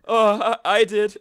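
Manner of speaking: high, loud mock worker reply; nervous cook. Worker voiced answering the kitchen crasher, high and loud. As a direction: answer timidly but loud. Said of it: "And the guy's like".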